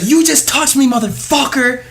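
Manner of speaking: high, shrieked accusation; mock furious yell. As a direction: shriek it like an enraged customer. Customer screaming accusation.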